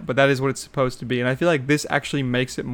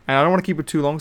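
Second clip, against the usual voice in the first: ordinary delivery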